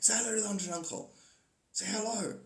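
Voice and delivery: playacted parent voice; nagging parent. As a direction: coax a reluctant child, insistently. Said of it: "like you got a kid".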